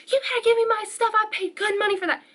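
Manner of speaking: high-pitched, worked-up demand; customer freaking out. Retold or imitated. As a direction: imitate an irate customer demanding, pitch jumping up. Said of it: "like, starts freaking out".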